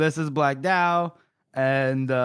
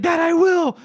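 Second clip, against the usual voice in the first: eager high shout; high, loud eager cry; 13 semitones higher, 7 dB louder than usual. Retold or imitated. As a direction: squeal it like a groveler. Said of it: "he's like".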